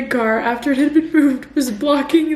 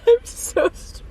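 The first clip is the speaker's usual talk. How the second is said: near tears; 7 semitones higher than usual. Real, not acted. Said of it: "I'm about to cry".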